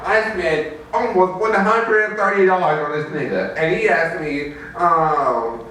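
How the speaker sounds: ordinary talk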